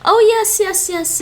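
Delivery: high, loud burst of realization; suddenly getting it, thrilled; excited yes. Real, not acted. Streamer suddenly gets the answer, excited.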